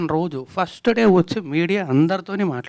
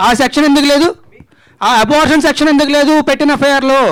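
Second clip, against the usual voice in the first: much higher and louder, demanding; heated challenge; 8 semitones higher, 8 dB louder than usual. Real, not acted. Actor disputes the FIR charge to reporter, loudly indignant. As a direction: throw the question back in a raised voice.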